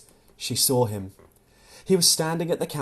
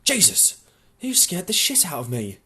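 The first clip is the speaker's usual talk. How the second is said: startled; 5 semitones higher than usual. Acted. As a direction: caught off guard, blurt it out. Tom startled by Louise.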